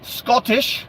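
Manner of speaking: high-pitched mock Scottish accent; amateur Scottish accent; attempted Scottish accent. Shown as a demonstration. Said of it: "I don't know if I sounded Scottish or".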